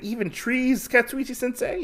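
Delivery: put-on high-pitched child pupil; little Usagi. Young pupil questioning his sensei, high childlike voice. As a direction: ask it in a high voice, like a young student. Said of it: "which involves little Usagi".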